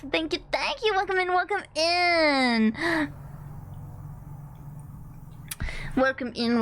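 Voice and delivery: high, bubbly train conductor welcome; cute anime streamer. Acted. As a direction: greet raiders in a sing-song high voice. Greeting new raiders.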